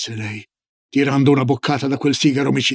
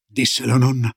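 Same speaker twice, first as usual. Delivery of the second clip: ordinary delivery, nothing marked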